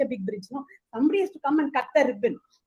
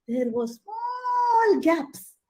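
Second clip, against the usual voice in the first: suspenseful reveal; 6 semitones higher, much slower than usual. A narrator's reading. Narrator's slow suspenseful reveal.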